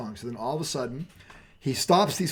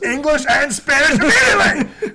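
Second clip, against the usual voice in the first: loud, raised, laughing command; booming order; 10 semitones higher, 11 dB louder than usual. Retold or imitated. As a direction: bellow the order to the whole school.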